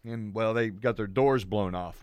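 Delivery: ordinary talk, nothing marked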